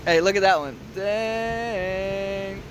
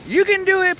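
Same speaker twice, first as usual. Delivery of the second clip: cheering; 12 semitones higher, 8 dB louder than usual. Real, not acted. Cheering on during lifting.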